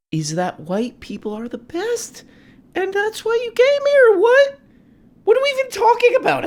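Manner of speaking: squeaky, exaggerated exasperation; parodied debater. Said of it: "That's the vibe from this guy".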